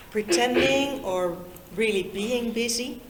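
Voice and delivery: normal talking voice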